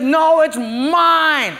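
put-on high, loud little girl's claim; child's protest. Granddaughter guarding her McNuggets from Papa, high indignant claim. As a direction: snap it back like a possessive kid.

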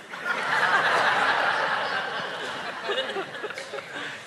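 soft airy laugh at the joke; breathy laugh. Real, not acted. Preacher laughing at his own story, quiet laugh.

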